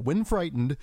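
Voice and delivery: ordinary delivery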